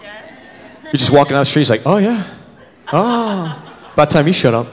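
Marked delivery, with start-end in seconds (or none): 1.9-4.7 lightly voiced leering creep, fading to a hush; creepy guy voice. Creep ogling a woman on the street, leering. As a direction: lean in like a slimy stranger.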